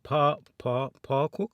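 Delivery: pa cook, clipped Jamaican for partially cook; Jamaican pronunciation. Shown as a demonstration. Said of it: "That's how you say it".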